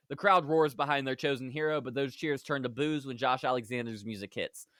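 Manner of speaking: usual speaking voice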